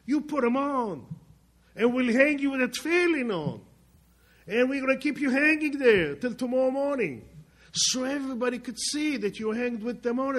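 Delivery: loud, high imitation of a German giving orders; shouted mock enthusiasm. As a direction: call it out for the whole crowd to hear. German officer's gloating orders, acted out.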